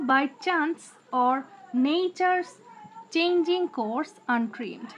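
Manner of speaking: slow, syllables pulled far apart; slow scansion reading. Shown as a demonstration. Teacher scanning the last line for the class, very slow. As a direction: read it out beat by beat. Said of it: "by chance এখানে একটা syllable".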